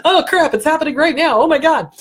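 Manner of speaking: high, loud mock panic; panicked amygdala. Amygdala panicking during an angry daydream, loud mock alarm. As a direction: blurt it like a startled alarm. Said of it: "the amygdala is going".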